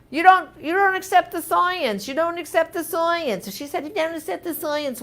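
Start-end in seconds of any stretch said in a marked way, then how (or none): 0.1-3.4 insistent harping, pitch high. Retold or imitated. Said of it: "did you hear how I kept harping". 3.8-5.0 harping. Retold or imitated. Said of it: "one more time".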